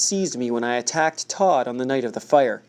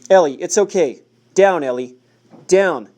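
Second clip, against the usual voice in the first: louder, firm order to the dog; commanding; 3 semitones higher, 6 dB louder than usual. A narrator's reading. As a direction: call the dog off firmly. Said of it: "the policeman commanded".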